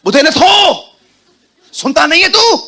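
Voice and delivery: high, loud scolding yell; rude shout. Acted. Voicing a rude shouting woman. (ऊँची, ज़ोरदार, रूखी डाँट)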